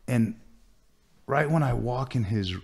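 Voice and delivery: ordinary delivery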